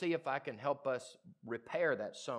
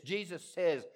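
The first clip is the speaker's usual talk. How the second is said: ordinary delivery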